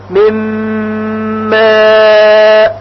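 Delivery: ماء held very slowly with a long humming م; من ماء, nun merged into a long nasal م; long nasal ghunna. Shown as a demonstration. Teacher demonstrates the merge to students, held hum.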